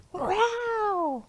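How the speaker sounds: high playful growl; tiger growl. Acted. Teacher growling along in the song, playful growl. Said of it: "you get to growl".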